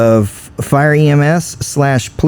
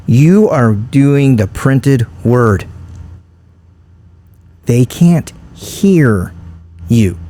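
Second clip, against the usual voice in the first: slow, spaced-out lecturing; emphatic instruction; much slower than usual. Retold or imitated. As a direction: spell it out slowly, word by word. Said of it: "He said".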